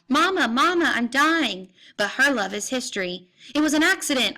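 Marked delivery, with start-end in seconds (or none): 0.1-1.7 high, loud wail for Mama; howled plea to a mother; dying howl. A narrator's reading. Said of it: "I howl". 3.5-4.4 high, loud bellowed protest; raised, insistent bellow. A narrator's reading. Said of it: "I bellow back".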